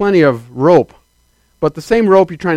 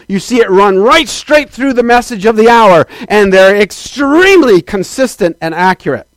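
raised, slow pulpit build, higher and louder; preaching at full force; 5 semitones higher, 6 dB louder than usual. Acted. Preacher to the saints, full-force fiery preaching. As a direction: drive it home to the congregation.

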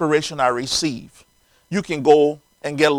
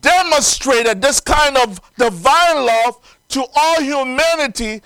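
raised, emphatic preacher's delivery; preaching; 8 semitones higher, 8 dB louder than usual. Acted. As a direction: preach it out with ringing, drawn-out phrase endings. Preaches at full force.

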